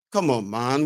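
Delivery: ordinary talk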